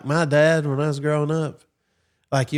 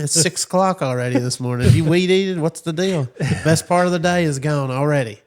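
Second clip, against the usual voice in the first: higher, louder gruff father scolding; mock stern dad, pitched up; strict dad; 3 semitones higher, 3 dB louder than usual. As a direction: chew out a lazy kid at dawn. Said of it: "it was like".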